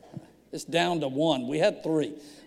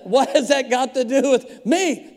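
baffled protest; 4 semitones higher, 5 dB louder than usual. Retold or imitated. Pastor retells protest to police.

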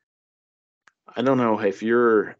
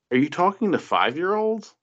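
much higher, mocking disbelief; incredulous; 10 semitones higher than usual. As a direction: scoff at the tone.

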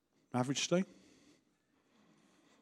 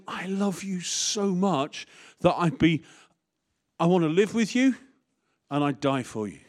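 Paul pleading; 6 semitones higher, 3 dB louder than usual. Acted. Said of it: "Is this Paul speaking or is it me?".

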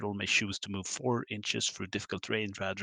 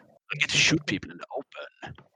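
high, loud gloating snake; 7 semitones higher, 5 dB louder than usual. Pretend. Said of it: "Do the snake voice".

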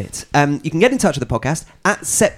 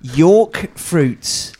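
higher, slow, drawn-out announcing; presenter announcement; 6 semitones higher, 3 dB louder, much slower than usual. Real, not acted. Host revealing today's food to friends, slow announcer voice. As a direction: unveil it slowly in a raised presenter voice.

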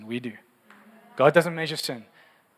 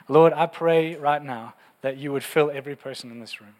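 prayer. Acted. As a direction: lead the room gently in prayer. Closing prayer.

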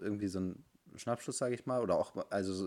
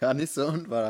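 higher, louder, amused echo; laughing agreement; 6 semitones higher, 8 dB louder than usual. Real, not acted. Friend repeating her line, laughing louder. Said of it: "hast du recht".

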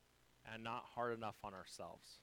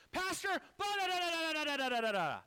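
shrill mocking yell of an upset person; mock angry shout; 8 dB louder than usual. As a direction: parody someone yelling in your face. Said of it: "they got up in my face".

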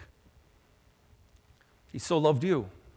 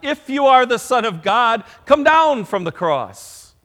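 mocking shout; 9 semitones higher, 12 dB louder than usual. Preacher voicing scoffers jeering at Jesus on the cross, loud mocking shout. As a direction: taunt him like a scoffer at the cross. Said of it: "they shouted at him and laughed".

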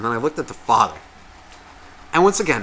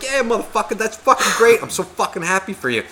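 higher-pitched, hammed-up congratulations; brash stranger; 7 semitones higher than usual. Father turning to a man waiting in line, mock brash cheer. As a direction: play the foul-mouthed guy cheering a stranger on. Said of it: "type of guy that would turn around".

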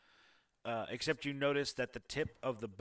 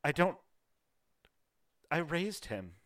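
breaking down; 6 semitones higher than usual. Acted. Widow breaks down.

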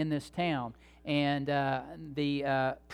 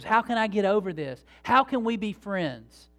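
louder, higher imitated plea; mock-earnest appeal, pitched up; retold plea; 5 semitones higher, 5 dB louder than usual.

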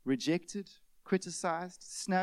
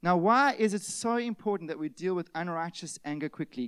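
ordinary delivery

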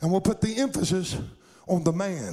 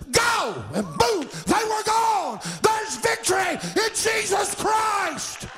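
very high, full-force pulpit shout; preaching shout; 13 semitones higher, 3 dB louder, much slower than usual. Real, not acted. Preacher proclaiming victory in Jesus to congregation, climactic shout. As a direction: shout the victory out to the whole church.

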